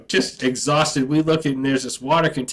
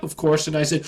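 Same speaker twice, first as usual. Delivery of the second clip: ordinary talk, nothing marked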